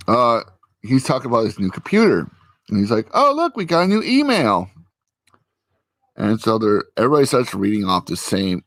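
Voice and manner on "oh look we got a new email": lightly voiced cheerful coworker, pitched way up; mock-bright office guy; chirpy coworker voice. Coworker cheerily announcing new email, high voice.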